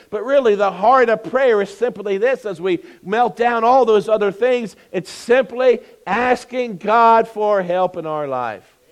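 loud, fervent preacher; preaching. Acted. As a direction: preach it from the pulpit. Preaching at full force.

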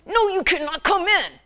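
high, loud sulky no; bratty daughter. Retold or imitated. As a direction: snap it back like a fed-up teen. Sulky daughter refuses dad.

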